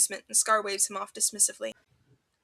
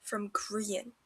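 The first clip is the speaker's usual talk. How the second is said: ordinary delivery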